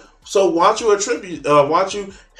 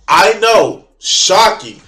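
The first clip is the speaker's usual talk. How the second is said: louder, exaggerated sarcastic astonishment; sarcastic mock shock; 3 semitones higher, 6 dB louder, much slower than usual. Creator mocking critics who feel the show excludes them, sarcastic. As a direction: play up fake shock, hitting it hard.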